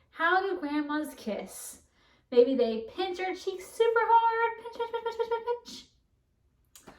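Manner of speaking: high, slow sing-song pinching; playful grandma voice. Pretend. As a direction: coo like a doting grandma.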